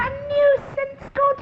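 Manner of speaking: high, slow genteel astonishment; surprised. Acted. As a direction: gasp it out, scandalised. Genteel hostess mock surprise.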